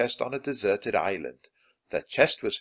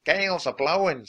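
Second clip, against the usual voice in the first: higher pirate sailor warning; pirate Pinky warning of the storm; 7 semitones higher, 4 dB louder than usual. A narrator's reading. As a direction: call out the gale like an old salt. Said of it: "said Pinky".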